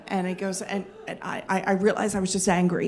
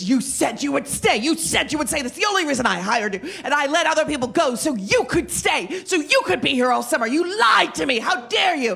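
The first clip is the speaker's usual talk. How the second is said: furious boss; 7 semitones higher than usual. Retold or imitated. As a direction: rage at her for breaking her word. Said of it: "turns even redder and angrier".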